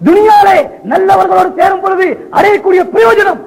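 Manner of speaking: high, emphatic sermon cry; full-force preaching. Acted. Preacher relaying the Prophet's answer on good company, full-force. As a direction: drive the lesson home, high.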